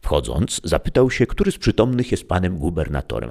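ordinary delivery, nothing marked

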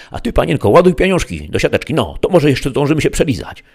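high, loud put-on crook voice; cocky stick-up man; robber ordering cashier. A narrator's reading. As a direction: boss the hostage around, high and loud.

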